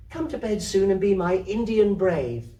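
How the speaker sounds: put-on high little girl pleading sweetly; coaxing child. Kezia coaxing her grandmother to bed, high and sweet. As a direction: coax grandma like a small child. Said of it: "Kezia to grandmother".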